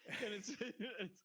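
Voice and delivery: talking through laughter, high and slow; words broken by laughs; laughing. Real, not acted. Laughing while retelling scene.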